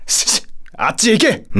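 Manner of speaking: stammered, high-pitched shoo; flustered shooing. Acted. Satan shooing a strange creature away, flustered.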